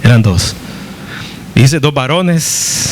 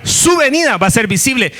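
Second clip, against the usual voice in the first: whole line lifted much higher, "su" stressed; emphatic higher repeat; 8 semitones higher than usual. Shown as a demonstration. Preacher hammering home the Second Coming, emphatic and high. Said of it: "Ahora, ahora".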